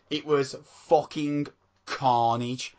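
usual speaking voice

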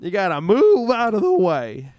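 high, loud cartoon cow yelling again. Cow voice redone for his co-host, high and loud. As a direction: holler it like a cow on a sled. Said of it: "You like that, cow?".

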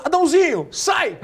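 high, loud laughing shout; calling out. Retold or imitated. Coach shouting a substitution to the field, loud. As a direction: call the player off the field. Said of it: "Aí ele falou".

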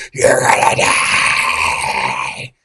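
imitating a stranger shouting a prophecy; retold scream. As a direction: moan the warning out long and low. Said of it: "pointing at me and screaming at me".

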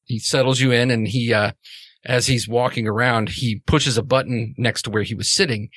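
ordinary delivery

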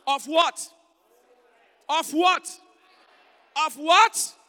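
building shout. Real, not acted. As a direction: build each call to a shout. Preacher building call and response.

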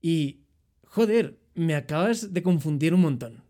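put-on lightly done Spanish accent from Spain; a hint of a Spanish receptionist's voice. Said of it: "el chico me dice". (con un leve acento español de España)

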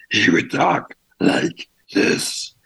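high, slow, airy rasp; strange villain voice. Shown as a demonstration. Showing the interviewer the old metal-armed villain's eerie slow rasp. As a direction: stretch it out in an eerie strangled half-whisper. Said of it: "he had a very strange voice".

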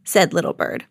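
ordinary delivery

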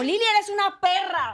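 high, playful villain insult; mock telenovela insult sent as a greeting; insulto de villana. Shown as a demonstration. Demonstrates the requested insult greeting. (insulto de villana en broma)

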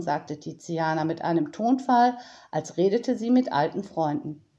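usual speaking voice

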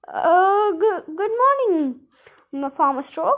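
nervous stutter; stammering nervously. Acted. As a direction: caught out, stammer it. Medal caught by the farmer.

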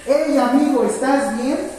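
loud, energetic check on a patient; energetic call. Shown as a demonstration. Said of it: "Las quiero enérgicas".

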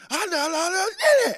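high, loud nonsense wail; imitated garbled wailing. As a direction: mimic a child's unclear crying shout. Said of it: "I'm hearing".

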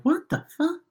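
very high, soft bewildered cry; mock bafflement at the sight; squeaky stunned disbelief. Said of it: "this look on their face, like".

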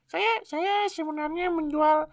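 anonymous witness with disguised voice. Pretend. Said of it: "suaranya disamarin gitu kan".

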